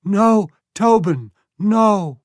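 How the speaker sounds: high, loud, slow pleading call; mother pleading. A narrator's reading. Mother calls to her son Tobin, pleading loudly. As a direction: beg her son to stop.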